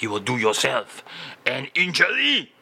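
ordinary delivery

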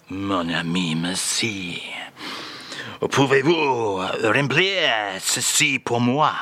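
a lightly done [name] with a French lilt, slowly; mock French [name]. [name] reads the French phrase to the host in a mock French accent. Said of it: "today's top French phrase".

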